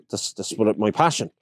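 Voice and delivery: ordinary talk, nothing marked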